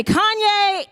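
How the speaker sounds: mock-announcing the name; imitated pointed call-out. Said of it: "pointed and say".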